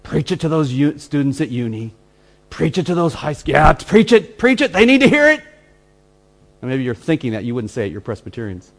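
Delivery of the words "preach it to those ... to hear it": mock cheering. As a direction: voice climbs into a high eager cheer. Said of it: "I could see myself saying".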